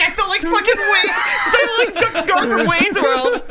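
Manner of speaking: excited shout. Retold or imitated. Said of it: "he's like".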